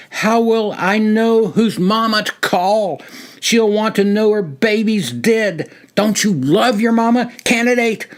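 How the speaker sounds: high, loud drill-sergeant tirade; shouting. A narrator's reading. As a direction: berate him right in his ear. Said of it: "and shouted".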